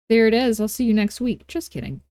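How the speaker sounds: ordinary talk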